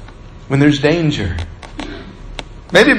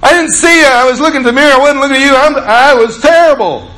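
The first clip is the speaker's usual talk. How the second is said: high, louder hurried apologizing; retold flustered apology; 10 semitones higher, 4 dB louder than usual. Bus driver apologizing to the car he cut off, high and flustered. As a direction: gush the apology, hands up.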